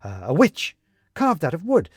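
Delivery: lightly voiced Benedict, uneasy; Benedict, anxious recollection. A narrator's reading. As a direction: rush through it as it slips away.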